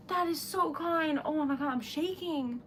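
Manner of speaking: normal talking voice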